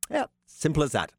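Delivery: ordinary talk, nothing marked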